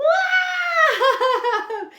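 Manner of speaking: loud high whoop into laughter; surprised shout-laugh. Real, not acted. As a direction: burst out with a high laughing yelp. Surprise on seeing craft.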